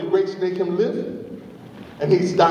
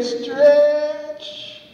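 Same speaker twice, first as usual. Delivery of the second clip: strained, higher, very slow STRETCH; stretched-out word; 8 semitones higher than usual. A narrator's reading. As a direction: STRETCH drawn out long and high. Narrator mimes the snake stretching.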